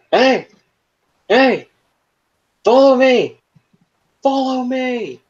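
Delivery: high, loud shout for attention; yelling. Acted. Arlette yelling at the big monster to follow, loud shout. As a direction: call out hard to draw it after you. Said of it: "she starts yelling".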